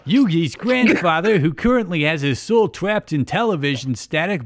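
high, loud cartoon hero protest; hammed-up shocked objection; indignant hero. A narrator's reading. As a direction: declaim it in earnest cartoon outrage. Yugi protests in fan fiction.

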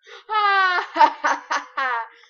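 high loud burst of laughter. Real, not acted. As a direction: laugh hard. Laughs at jam grabbing.

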